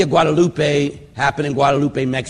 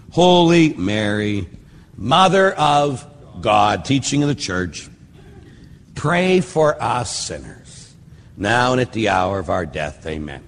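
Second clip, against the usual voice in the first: slow prayer; 4 semitones lower than usual. Shown as a demonstration. Priest praying the second half aloud, slow and reverent. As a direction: pray it slowly, sinking to a hush on amen.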